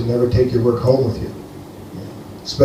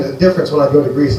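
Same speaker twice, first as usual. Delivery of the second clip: normal talking voice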